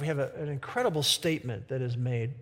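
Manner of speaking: ordinary talk, nothing marked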